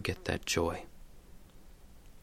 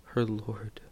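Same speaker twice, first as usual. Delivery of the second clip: ordinary talk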